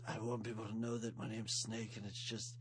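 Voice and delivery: low, soft tough-guy voice; Snake impression. Tough prisoner asking tattoo artist for snake, low and soft. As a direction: drop the voice low and quiet like a hard man. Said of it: "Amazing snake imitation".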